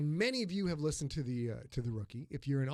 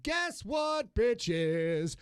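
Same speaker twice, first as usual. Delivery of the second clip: higher, slow showman's cry; hammed-up announcement; 6 semitones higher, much slower than usual. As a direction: sell it like a game-show host.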